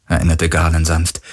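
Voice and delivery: ordinary delivery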